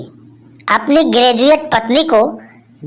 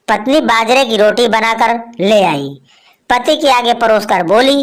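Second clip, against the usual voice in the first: normal talking voice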